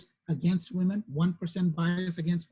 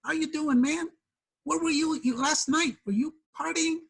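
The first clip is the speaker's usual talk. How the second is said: higher-pitched, chummy stranger impression; casual buddy greeting; 9 semitones higher than usual. People treating the earring wearer as a party guy, chummy. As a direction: imitate an over-familiar party pal, pitched up. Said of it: "lots of people call me man".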